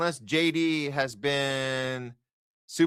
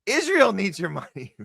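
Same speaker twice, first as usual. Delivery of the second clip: sarcastic mock scolding; 7 semitones higher than usual.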